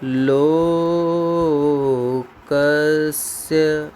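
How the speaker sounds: लोकस्य held long on a steady tone; slow sing-song dictation. Shown as a demonstration. Teacher dictating the answer to students, slow and chanted. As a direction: chant लोकस्य slowly for copying. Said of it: "इसकी जगह क्या लिखेंगे?".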